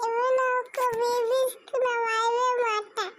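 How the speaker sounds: high, slow sobbing refusal; tearful, high-voiced little kid; crying child. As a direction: refuse through tears, dragging the words out. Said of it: "அழுகுறா பாத்தியா?".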